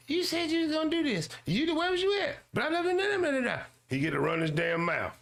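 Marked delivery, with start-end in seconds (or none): 0.1-3.6 high, fast mocking imitation of a woman yelling; mock nagging woman. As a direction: squeak it out like a nagging wife, hammed-up. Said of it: "She yelling at him".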